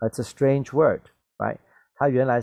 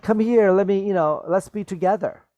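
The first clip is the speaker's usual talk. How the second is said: excited mock quote; 8 semitones higher, 4 dB louder than usual. Acting Echo's hopeful reading.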